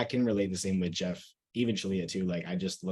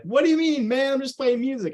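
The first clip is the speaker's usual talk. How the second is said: much higher and louder, mock-innocent Jeff; goofy Jeff voice; 9 dB louder than usual. Friend imitating Jeff acting innocent while rickrolling him, high and loud. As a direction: play dumb in a squeaky high put-on voice.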